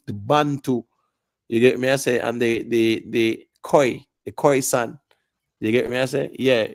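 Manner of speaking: ordinary delivery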